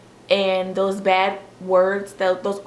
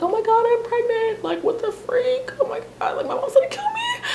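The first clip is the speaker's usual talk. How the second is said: high-pitched squealing panic, laughing and half crying; reliving the shock with a shrieking laugh; retold pregnancy scream. Telling her daughter about finding out she was pregnant, high laughing squeal. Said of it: "and I was screaming".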